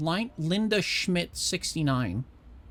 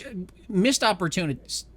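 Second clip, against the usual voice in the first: usual speaking voice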